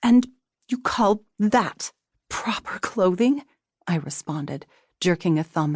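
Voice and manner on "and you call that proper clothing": high, slow, indignant retort; flaring anger. A narrator's reading. Wife indignant at her husband over the pants, angry. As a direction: throw the question back at him. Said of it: "Sudden anger flared within me".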